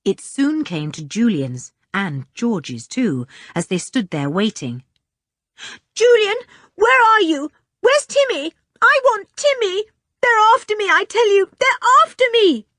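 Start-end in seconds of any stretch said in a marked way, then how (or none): 5.9-12.7 high, loud panicked cries for help; terrified yelling. A narrator's reading. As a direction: scream for Julian in terror. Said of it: "shouting and yelling, half sobbing".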